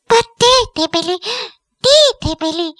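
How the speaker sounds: high, insistent begging; pleading headmistress. A narrator's reading. Headmistress pressing the spirit for her faults, high and pleading.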